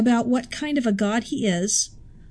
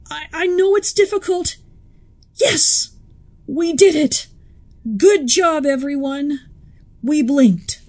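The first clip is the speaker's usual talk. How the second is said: triumphant cheer; 3 semitones higher, 4 dB louder than usual. Pretend. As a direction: cheer the team's victory. Celebrating the blink.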